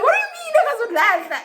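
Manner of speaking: high, loud, fast exclamation of disbelief; acting out a shocked outburst; alarmed outburst. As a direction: shriek it in panicked disbelief. Retold alarmed reply to him.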